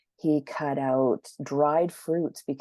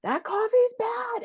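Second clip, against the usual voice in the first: high, loud alarmed doctor; mock freaking-out doctor; 3 dB louder than usual. Naturopath scolding her drink, high mock alarm. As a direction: ham up the doctor's panic. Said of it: "and he's freaking out".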